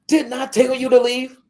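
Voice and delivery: loud high exasperated bark; angry demand. Retold or imitated. Landowner scolding the girl who won't leave, loud. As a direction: scold her hard for staying. Landowner repeating his order.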